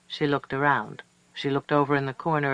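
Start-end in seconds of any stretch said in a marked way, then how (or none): none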